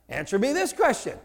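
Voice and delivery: normal talking voice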